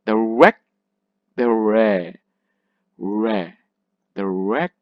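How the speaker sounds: records as noun, long REC then weak kurds; stress on first syllable. Shown as a demonstration. Teacher trying out the noun, stress on REC in records. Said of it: "this is actually the opposite".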